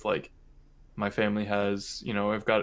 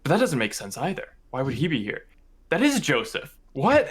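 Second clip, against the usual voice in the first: rising, higher and louder to a sudden what; mom realizing; 4 semitones higher, 3 dB louder than usual. Retold or imitated. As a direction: build from puzzled to startled recognition. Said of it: "she's like".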